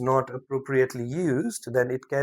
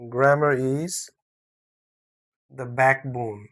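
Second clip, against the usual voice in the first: slower, louder, with a pause before "the backbone"; emphatic repeat; 4 dB louder than usual. Shown as a demonstration. Teacher repeating the key point for emphasis, slow and emphatic. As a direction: hammer it in, pausing before "backbone".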